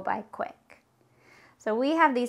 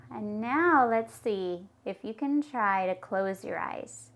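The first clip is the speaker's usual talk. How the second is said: guided meditation. Acted. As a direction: guide them gently up onto the cloud. Leads a cloud meditation.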